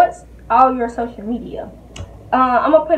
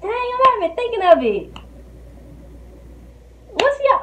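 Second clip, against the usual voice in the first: frustrated; 11 semitones higher than usual. Real, not acted. Struggling to remember.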